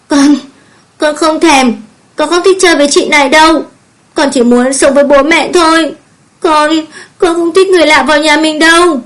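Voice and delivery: put-on high, tearful child's protest; sobbing little girl. A narrator's reading. Small girl refusing a stranger in her home, sobbing. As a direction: wail it like an upset small child. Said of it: "mếu máo khóc hu hu".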